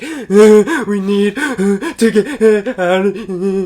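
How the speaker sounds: high, loud, drawn-out hammed-up wailing; sobbing little kid. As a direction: imitate a child gasping words out between sobs. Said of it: "I sound like a little kid".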